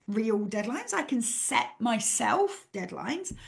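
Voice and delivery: usual speaking voice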